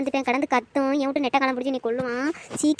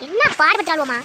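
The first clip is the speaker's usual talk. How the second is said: higher, louder snapping retort; the rival aunt snaps back in a loud retort; angry retort; 6 semitones higher, 5 dB louder than usual. Acted. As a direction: fire back at her rival.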